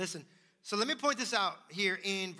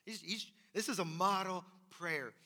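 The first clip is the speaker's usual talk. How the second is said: usual speaking voice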